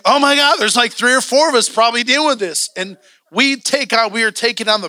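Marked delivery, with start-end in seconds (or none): 0.0-2.7 high, loud re-enacted astonishment; raised-pitch oh-my-God moment; sudden realization. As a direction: blurt out what just dawned on you. Acts out his sudden realization.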